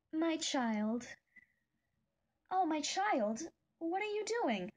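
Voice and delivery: gentle goat mom asking what you're doing; Toriel voice. Acted. Viewer asked for an Undertale impression, soft motherly Toriel. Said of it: "I'll do Toriel first".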